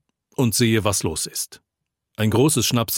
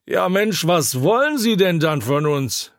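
high-pitched exasperated cry; tipsy shout; 8 semitones higher than usual. A narrator's reading. Half-tipsy drinker challenges the pastor, shouting. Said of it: "schreit ein halb angesäuselter".

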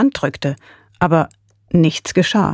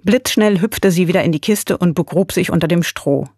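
plain reading voice

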